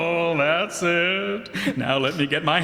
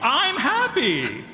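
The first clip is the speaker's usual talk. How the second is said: insisting he's happy; 12 semitones higher than usual. Acted. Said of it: "I'm not crying. I'm happy".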